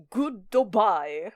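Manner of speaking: goodbye stretched as kʊdːobaɪ, Japanese-style; Japanese accent. Retold or imitated. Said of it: "He says".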